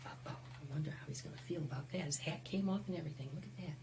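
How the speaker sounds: fast, soft whisper; whispered aside. Real, not acted. Storyteller whispering to kids about Pedro's crash, quick and soft. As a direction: hush it like a worried secret. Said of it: "aside to children".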